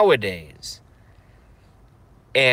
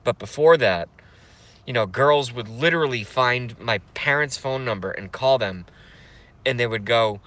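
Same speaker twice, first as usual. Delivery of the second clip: normal talking voice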